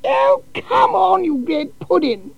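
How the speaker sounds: very high, slow, plummy calling out; a posh lady cheering from the terraces. A narrator's reading. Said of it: "called out in a rather posh sort of voice".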